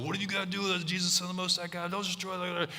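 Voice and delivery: high, rushed mock plea; frantic demoniac. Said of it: "The guy comes running down the hill".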